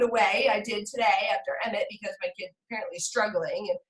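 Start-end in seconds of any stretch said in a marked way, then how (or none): none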